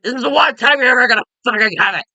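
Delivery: high, loud ranting yell; angry outburst. Acted. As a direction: blow up at him through the screen. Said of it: "it just angers me".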